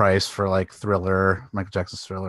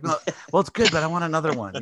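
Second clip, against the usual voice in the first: patient director; 7 semitones higher, faster than usual. Retold or imitated. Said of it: "It's like".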